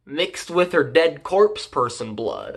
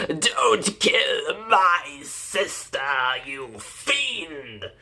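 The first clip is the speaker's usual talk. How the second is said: slow, stretched theatrical plea; hammy melodramatic hero; 6 dB quieter, much slower than usual. Acted. Brother defends sister from slayer, hammy melodrama. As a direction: overact it, drawing every word out.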